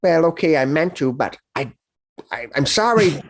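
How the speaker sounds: put-on a scolded teenager backpedalling; a young orc apologising to his elder; sheepish young orc.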